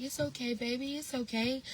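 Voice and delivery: put-on soothing a child. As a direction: coo it gently, like comforting a little one. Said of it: "I could have been somebody like".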